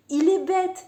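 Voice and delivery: high, incredulous exclamation; exasperated outburst. Real, not acted. Reader reacting to the smitten author, exasperated. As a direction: burst out in real disbelief.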